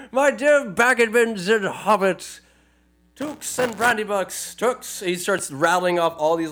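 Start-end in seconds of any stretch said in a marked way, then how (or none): 0.1-5.0 Bilbo speech. Retold or imitated.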